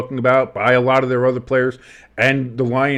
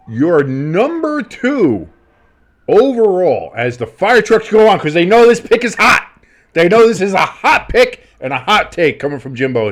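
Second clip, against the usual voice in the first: higher, louder showman build-up; hype announcer; 7 semitones higher, 5 dB louder than usual. Acted. Introducing Jimbo's hot pick, hype announcer voice. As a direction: sell it like a big reveal.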